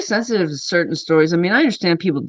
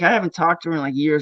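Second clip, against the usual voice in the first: ordinary delivery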